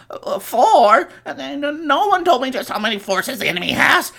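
high, loud, far-off whine; whiny panicking teen. Acted. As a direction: whine like a scared kid pilot. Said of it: "he's all".

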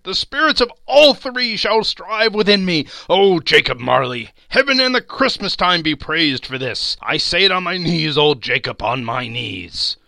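loud, fervent thanks; overwhelmed, elated vow; fluttered and glowing. A narrator's reading. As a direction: pour out joyful gratitude, easing at the end. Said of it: "so fluttered and so glowing with his good intentions".

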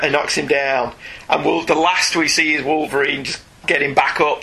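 ordinary delivery

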